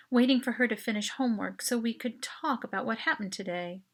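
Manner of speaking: ordinary delivery, nothing marked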